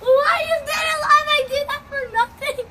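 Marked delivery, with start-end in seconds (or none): 0.0-1.3 startled shout. Real, not acted.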